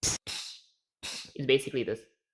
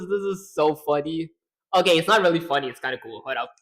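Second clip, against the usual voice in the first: usual speaking voice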